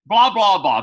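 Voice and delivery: quick loud throwaway blah blah; dismissive skipping. Real, not acted. Storyteller skipping the boring part, dismissive. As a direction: wave off the rest of the story. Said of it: "skipping the details".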